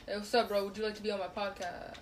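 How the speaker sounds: ordinary delivery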